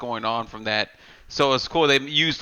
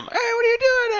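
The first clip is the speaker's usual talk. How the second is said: much higher put-on kid's voice, challenging; squeaky kid voice. Little cousin confronting the cook in a squeaky high kid voice. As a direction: pitch it way up like a scrappy little kid.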